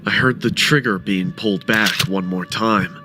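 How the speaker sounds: usual speaking voice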